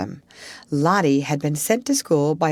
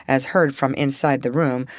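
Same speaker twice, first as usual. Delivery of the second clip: plain reading voice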